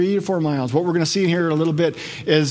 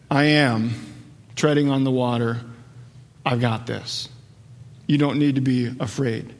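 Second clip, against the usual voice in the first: calm reassurance; 5 semitones lower than usual. Retold or imitated. Preacher voices God to fearful listeners, calmly reassuring. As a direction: settle them down with gentle certainty.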